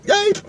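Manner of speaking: very high yelp like a kicked dog; dog yelp imitation. His dog Muffin getting kicked, imitated high yelp.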